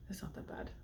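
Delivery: surprised soft whisper. Real, not acted. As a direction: half-whisper it to herself after tasting.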